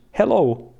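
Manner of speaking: "Hello" said with Finnish vowels, open e and a pure o; a light Finnish accent on the hello greeting. Shown as a demonstration. Streamer showing chat the Finnish accent, light Finnish accent. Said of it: "and this is Finnish".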